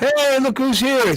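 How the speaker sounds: high, drawn-out welcome; excited greeting. Real, not acted. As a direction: hail the new arrival, big and slow. Greets arriving guest loudly.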